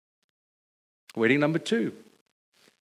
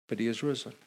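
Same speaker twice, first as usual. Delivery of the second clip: softer, lower: but he is risen; quiet refrain; 5 semitones lower, 10 dB quieter, much faster than usual. Acted. Preacher answering each suffering of Christ, soft and low. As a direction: let the answer fall soft and sure.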